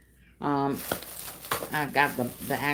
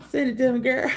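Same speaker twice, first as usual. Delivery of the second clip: laughing; 7 semitones higher than usual. Real, not acted. Teasing a viewer, laughing.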